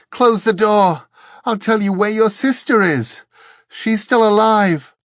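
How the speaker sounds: very high, loud begging; desperate pleading. A narrator's reading. Angel bargaining with Dor over a sister, pleading. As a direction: cry out a frantic plea. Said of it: "it pleaded".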